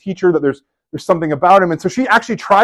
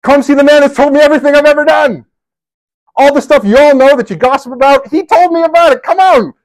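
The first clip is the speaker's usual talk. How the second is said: much higher and louder, calling to the whole town; excited calling out; 11 semitones higher, 7 dB louder than usual. Retold or imitated. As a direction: burst out to the townspeople, thrilled. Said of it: "she runs through the town and says".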